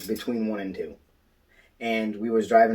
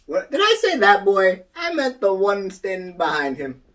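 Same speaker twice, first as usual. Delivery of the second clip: mock backtracking; 9 semitones higher, 6 dB louder than usual. Jokingly voices backtracking kid.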